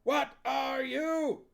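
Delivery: high, very slow screamed question. Acted. Huel screaming at the witches, slow high scream. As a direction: scream it at the troops. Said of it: "he screamed".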